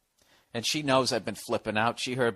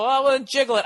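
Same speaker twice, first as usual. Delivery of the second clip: dad's suggestion; 13 semitones higher, 6 dB louder, faster than usual. Retold or imitated. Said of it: "And he go".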